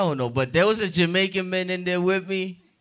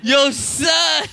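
big Brooklyn inmate; 7 semitones higher than usual. Retold or imitated. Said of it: "some big dude in jail straight out of Brooklyn".

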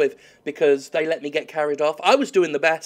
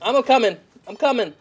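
calling out; 7 semitones higher, 4 dB louder than usual. Real, not acted. Rushing to help teammate.

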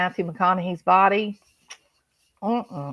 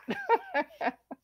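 high, quiet giggle; 14 semitones higher, 10 dB quieter than usual. Real, not acted. Laughs after swooning.

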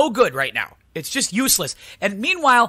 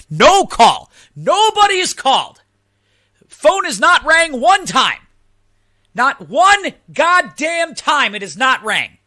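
louder, higher, slowing indignant outburst; furious rant; 7 semitones higher, 4 dB louder, slower than usual. Retold or imitated. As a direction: hammer each phrase in outrage. Building rant about silent phone.

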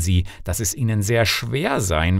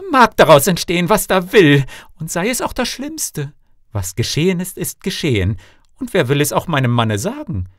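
high, defiant self-persuasion; Canetella talking to herself; 8 semitones higher, 4 dB louder than usual. A narrator's reading. Wife dares to disobey her husband, high defiant self-talk. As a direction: talk yourself into it, voice pitched up.

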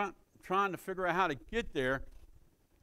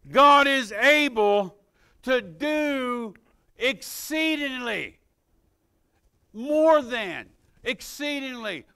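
full-force preaching; 5 semitones higher, 8 dB louder than usual. Real, not acted. Preacher declaring that God is able, fervent and loud. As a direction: belt out each phrase to the congregation.

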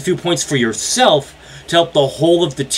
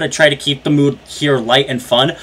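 ordinary delivery

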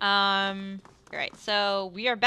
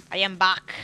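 put-on failed Austrian accent; 4 dB louder than usual. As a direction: growl "I am back" in a bad Austrian accent. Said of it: "I can't do an Austrian accent".

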